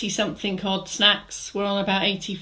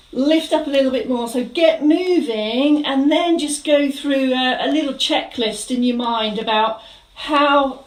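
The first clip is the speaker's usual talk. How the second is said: fitness instructor cueing; 3 semitones higher than usual. Acted. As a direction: coach the room through each move with energy. Leads a live mobility class.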